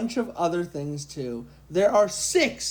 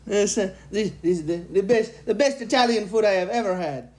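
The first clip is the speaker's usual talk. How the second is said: mock Italian accent, hammed up; a light, half-hearted Italian accent. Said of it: "talk in an Italian accent".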